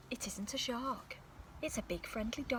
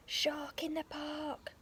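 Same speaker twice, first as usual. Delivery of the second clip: higher, slow calling out; boy shouting his warning once more; eager shout; 6 semitones higher, slower than usual. Acted. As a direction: call it out as if fit to burst. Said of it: "till he's fit to burst".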